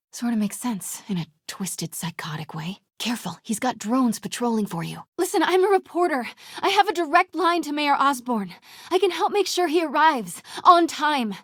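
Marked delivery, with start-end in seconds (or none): 5.2-11.3 higher, louder confident bluff; put-on reporter confidence. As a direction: sell the lie brightly.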